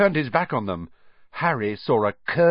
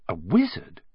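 usual speaking voice

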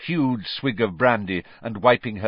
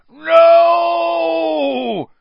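very high, loud, long cry of horror; loud high wail; panicked scream; 10 dB louder than usual. A narrator's reading. Vernon as Marge floats off.

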